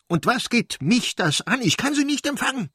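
high, loud outraged shriek; screaming. A narrator's reading. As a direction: scream the refusal at your husband. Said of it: "schrie sie". (schrill und aufgebracht schreien)

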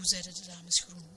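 ordinary delivery, nothing marked

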